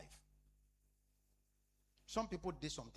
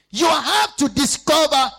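slow, much louder and higher preaching cry; preaching shout; 13 semitones higher, 25 dB louder, slower than usual. Acted. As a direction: hammer the phrase home to the church. Preacher shouts key phrase.

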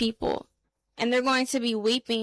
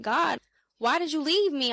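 higher, mildly pleading cry to God; mock-tearful plea, pitched up; retold anguished plea; 4 semitones higher than usual. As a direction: retell a desperate cry without fully crying. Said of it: "weeping and crying and screaming at the Lord".

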